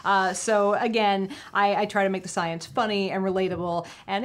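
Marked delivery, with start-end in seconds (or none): none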